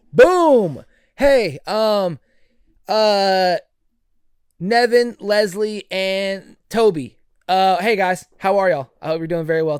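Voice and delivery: loud, high shout-out to fans; excited shout-out. Real, not acted. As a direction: call out names like a hype man.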